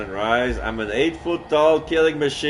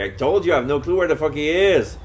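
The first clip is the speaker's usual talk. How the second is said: angry yelling; 5 semitones higher, 4 dB louder, faster than usual. Acted. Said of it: "I shouted my rage".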